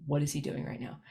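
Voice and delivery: hushed suspicion at the silence; suspicious hush. Retold or imitated. Family noticing the quiet house, hushed and suspicious.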